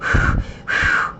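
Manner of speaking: short breathy puffs; blowing. Retold or imitated. Host showing how he tried to nudge the wheel, puffs of blown air. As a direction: blow hard at a screen. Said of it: "I was trying to blow the screen".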